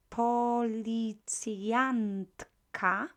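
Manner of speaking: policjantka very slow, drawn out; slow model word. Shown as a demonstration. Teacher modelling policjantka for class. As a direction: sound out policjantka. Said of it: "teacher models word".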